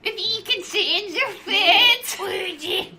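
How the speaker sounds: high, loud, drawn-out silly voice; exaggerated babyish old lady. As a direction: squeal it slowly like an overgrown baby. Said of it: "why is she baby?".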